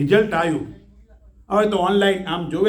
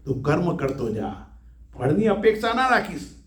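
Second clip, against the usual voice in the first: ordinary delivery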